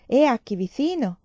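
the matron quoting, loud; lilting Spanish lady, first take. A narrator's reading. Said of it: "Parlava a voce alta, stridula e cadenzata". (signora spagnola, prima volta più forte)

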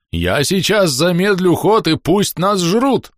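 loud, high, drawn-out threat; yelling. A narrator's reading. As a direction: yell it slowly at full pitch. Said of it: "заорал Клест". (орёт, растягивая слова)

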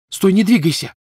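loud, high urgent shout. A narrator's reading. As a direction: stop him now. Hermione stops Ron.

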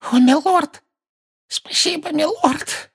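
high, hoarse relieved servant voice; hoarse with relief. A narrator's reading. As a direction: gasp thanks in relief. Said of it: "голосом, хриплым от облегчения". (хриплый от облегчения голос)

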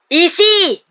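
high, loud shout for attention; sudden shout. A narrator's reading. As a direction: cut in with an urgent yell. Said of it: "cria soudain le professeur Lupin". (cri soudain, aigu et fort)

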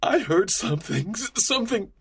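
high, frightened stammer; scared prisoner struggling to get it out; terrified. Acted. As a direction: stumble over it in fear.

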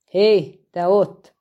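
loud, challenging call across the field; shouting. A narrator's reading. Dwarf herald yelling up at the dragon, loud. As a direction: hands on hips, yell it out at the dragon. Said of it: "kiabált a csípőre tett kézzel".

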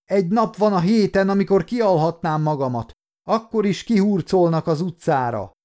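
high-pitched narrated tirade; angry yell. A narrator's reading. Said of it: "ordította".

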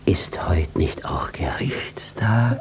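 slow anxious whisper. Acted. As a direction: whisper it slowly, dreading the answer. Judge uneasy, asks fearfully. (ängstlich geflüstert)

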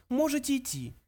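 high mouse voice, offhand; offhand little mouse; careless dismissal. A narrator's reading. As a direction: wave him away in a tiny high voice. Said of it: "небрежно произнёс Бенджи".

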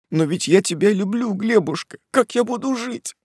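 high, despairing tearful plea; cling to him, voice rising. Acted.

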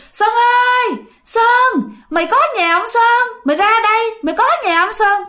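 high, far-off ghostly call; haunting call for Sơn, pitched high; eerie calling voice. Retold or imitated. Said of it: "mà kêu, kêu liên hồi luôn".

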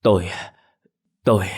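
halting, stuck on the word; stammering. A narrator's reading. Dazed Lâm stammering at the crowd, halting. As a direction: stumble over it speechlessly. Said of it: "miệng liên tục ú ớ".

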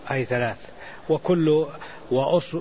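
ordinary delivery

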